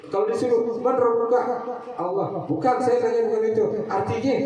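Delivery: high, lively teasing questioner; lightly voiced strict examiner; mock quiz. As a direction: play the examiner catching the answer out. Acted teacher-student exchange.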